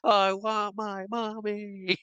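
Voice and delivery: slow, drawn-out small voice; mock whimpering child. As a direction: imitate a kid's plaintive wail, held back. Echoes rider wanting out.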